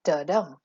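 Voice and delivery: "da-dum" with a light da and a heavy dum; unstressed then stressed. Shown as a demonstration. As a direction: land on the dum of "da-dum". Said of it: "unstressed syllable followed by a stressed syllable".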